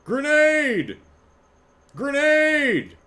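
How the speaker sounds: high shouted "Grenade!" imitation; grenade warning yell. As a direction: yell it like a game soldier. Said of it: "one sound clip for".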